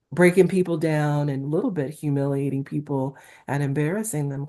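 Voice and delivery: usual speaking voice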